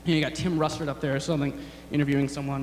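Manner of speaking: normal talking voice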